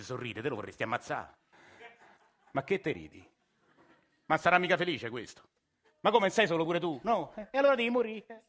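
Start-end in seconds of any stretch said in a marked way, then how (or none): 2.5-8.4 climbs higher and louder to a shrill peak; building rage. Acted. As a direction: work yourself up at a stranger until you explode. Ranting at smiling driver.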